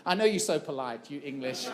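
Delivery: usual speaking voice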